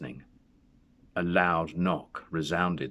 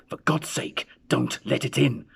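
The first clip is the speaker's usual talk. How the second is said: higher, breathy terrified plea; trembling cry; 7 semitones higher, 4 dB louder than usual. A narrator's reading. Old man begging his wife not to open the door, terrified. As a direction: beg her in terror. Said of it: "cried the old man, trembling".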